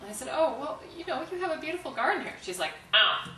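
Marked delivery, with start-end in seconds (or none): 0.3-2.3 high, louder, eager-to-please retold compliment; friendly small talk. Keeps trying friendly small talk. 2.9-3.3 loud, curt retold grunt; gruff Boston rudeness. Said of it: "Boston is notoriously rude".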